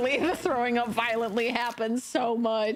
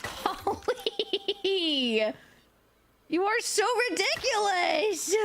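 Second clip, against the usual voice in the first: amused exclaim; 7 semitones higher, slower than usual. Real, not acted. Laughing exclamation at dog.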